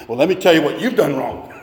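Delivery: a hint of a prickly self-justifier; defensive excuse. Pastor mimicking a defensive spouse, mildly mock-defensive. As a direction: bat the blame back, mildly mock-defensive. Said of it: "the method we love to use".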